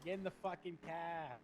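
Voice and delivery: slow, drawn-out Boston accent; Boston accent like mom. Shown as a demonstration. Showing Jenna how mom talks, slow Boston accent. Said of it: "I sound like my mom".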